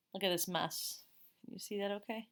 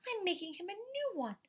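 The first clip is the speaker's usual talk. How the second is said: put-on much higher boy's voice; child voice; 13 semitones higher than usual. A narrator's reading. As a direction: answer brightly like a small boy. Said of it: "Spencer replied".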